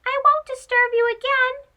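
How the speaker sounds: very high, quick timid mouse voice; softly explaining mouse. Acted. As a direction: explain nervously like a small mouse. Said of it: "Chico explained softly".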